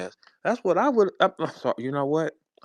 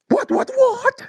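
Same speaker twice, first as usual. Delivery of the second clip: very high, loud falsetto yelps; rapid-fire squeaky outburst; mock shock; 7 dB louder, slower than usual. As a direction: squeal it in exaggerated disbelief. Mock shocked reaction to hypothetical.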